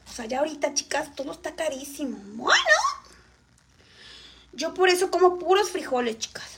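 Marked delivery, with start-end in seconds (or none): none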